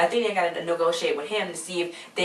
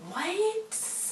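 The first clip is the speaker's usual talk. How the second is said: high, drawn-out, softer what; incredulous what; 6 dB quieter than usual. Retold or imitated. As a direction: squeak out a long, disbelieving what. Mock disbelief at the plot.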